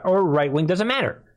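ordinary delivery

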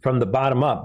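ordinary delivery

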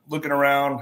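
normal talking voice

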